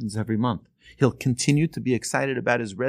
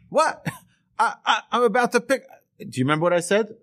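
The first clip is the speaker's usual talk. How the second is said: shocked protest; 10 semitones higher than usual. Retold or imitated. Nephew told by his uncle to bring the car back, shocked. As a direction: sputter in disbelief, stumbling over words.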